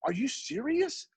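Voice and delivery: high, slow disbelieving question; incredulous kid. Retold or imitated. Kid hearing painting can be a job, incredulous. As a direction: imitate an amazed kid, voice lifted high.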